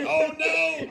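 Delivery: high shouted oh no; high, loud mock dismay; dismayed cry. A narrator's reading. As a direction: cry out in comic alarm. Storyteller voicing Mister Bump's dismay.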